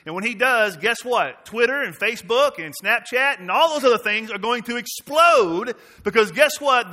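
loud, driving pulpit preaching. Acted. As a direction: preach it out boldly to the church. Pastor preaching to congregation.

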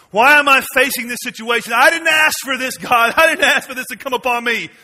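high, loud cry of complaint; anguished protest. Acted. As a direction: throw the complaint up at God. Quoting someone protesting to God.

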